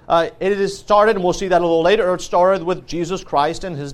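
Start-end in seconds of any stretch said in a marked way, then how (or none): none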